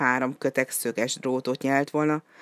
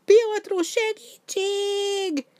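cry for help; much slower than usual. A narrator's reading. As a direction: scream for help in pain. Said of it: "kiabált fájdalmában".

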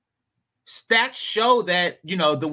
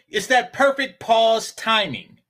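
ordinary talk, nothing marked

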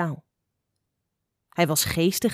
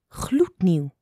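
whispered. Acted. Situation: Uil answers mother, whispered word.

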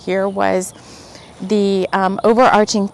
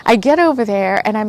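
usual speaking voice

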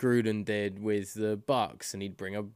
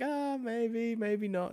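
doubtful mock; 12 semitones higher, much slower than usual. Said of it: "everyone was like".

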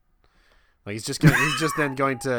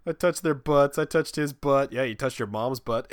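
usual speaking voice

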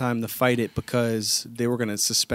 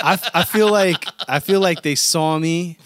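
louder and higher, protesting hard; heated protest; 5 semitones higher, 6 dB louder than usual. Real, not acted. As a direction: push back, worked up. Indignant protest of profiling.

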